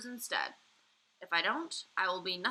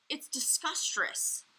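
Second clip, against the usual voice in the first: gurgling; 6 semitones higher, slower than usual. A narrator's reading. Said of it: "the B F G gurgled".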